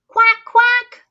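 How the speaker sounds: high, loud quack; duck quack. A narrator's reading. Dog quacks.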